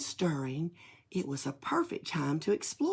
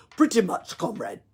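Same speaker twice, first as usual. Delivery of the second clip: put-on louder, casual Russian-accented Nutcracker; 3 semitones higher, 6 dB louder than usual. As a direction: answer offhand in a Russian accent. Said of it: "said the Nutcracker".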